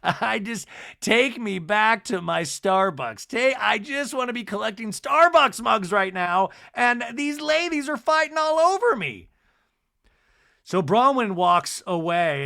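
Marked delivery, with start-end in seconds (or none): 0.0-9.3 whiny husband. Retold or imitated. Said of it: "he's like".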